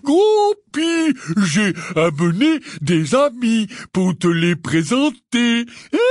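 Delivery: half-giant gamekeeper cajoling his giant brother; Hagrid's coaxing voice. Acted. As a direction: talk to a huge brother like a child.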